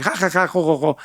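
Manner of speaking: spoken mock ha-ha, ho-ho; half-hearted imitation of laughing; imitated laughter. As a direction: fake a group's laugh in syllables. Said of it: "se rieron los otros".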